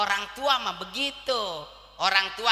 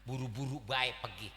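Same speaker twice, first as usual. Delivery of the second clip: ordinary delivery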